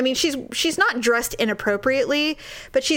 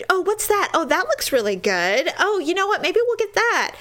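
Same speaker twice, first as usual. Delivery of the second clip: higher-pitched gushing over passing dishes; imitating nosy diner; 7 semitones higher than usual. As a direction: lightly mimic an eager restaurant customer. Woman stopping waiters, acted out.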